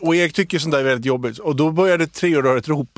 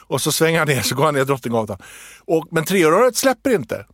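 normal talking voice

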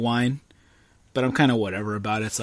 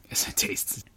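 whispering; 9 dB quieter than usual. Real, not acted. Whispering mid-sentence, laughing.

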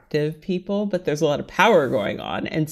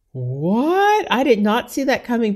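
high, rising shock; stunned, indignant surprise; blindsided disbelief; 6 semitones higher, 4 dB louder than usual. Retold or imitated. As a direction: react as if blindsided, pitch climbing. Acted reaction to being dumped.

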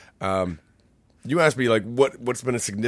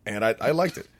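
ordinary delivery

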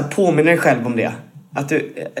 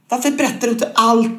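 mock demanding partygoer; 7 semitones higher than usual. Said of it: "så tänker man så här".

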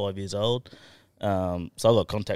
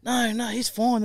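manager; 4 dB louder, slower than usual. Retold or imitated. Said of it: "she goes".

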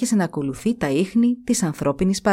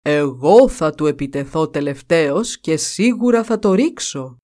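buffalo chief boasting; 3 semitones lower than usual. A narrator's reading. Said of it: "Είπε τότε ο Βούβαλος".

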